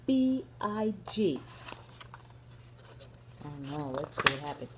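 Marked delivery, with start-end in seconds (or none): none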